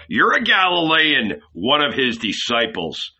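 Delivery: nail him as a Galilean; shouted accusation. A narrator's reading. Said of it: "they shouted".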